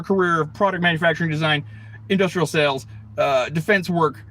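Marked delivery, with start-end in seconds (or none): none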